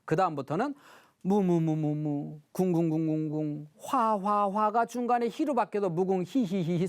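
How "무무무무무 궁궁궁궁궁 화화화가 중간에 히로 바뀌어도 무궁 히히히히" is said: put-on bored child droning. Child mechanically repeating syllables.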